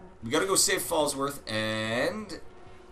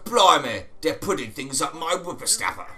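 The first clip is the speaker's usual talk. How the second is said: mock English accent; 4 semitones higher, 6 dB louder than usual. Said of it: "He's like".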